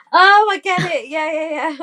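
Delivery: imitating the girl catching on, high and loud; hammed-up "oh I get it" voice; mock sudden realisation. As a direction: play the penny dropping, bright and eager. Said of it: "And then she was like".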